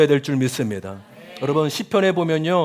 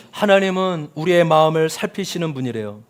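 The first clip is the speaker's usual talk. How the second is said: ordinary talk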